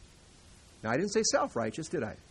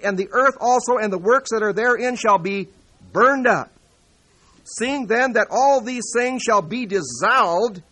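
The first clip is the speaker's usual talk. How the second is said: fiery pulpit proclamation, louder and higher; full-force preaching; 6 semitones higher, 9 dB louder than usual. Acted. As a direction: thunder out the verses to the congregation.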